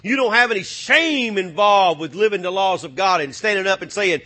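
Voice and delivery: ordinary delivery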